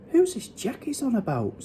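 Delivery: soft, high little voice; whisper. A narrator's reading. Sophie quietly asks the BFG about Jack, whispering. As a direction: ask it softly and high. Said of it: "Sophie whispered".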